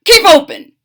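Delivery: high, loud command; yelled. Acted. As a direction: shout at the fox. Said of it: "yelled Doctor DeSoto".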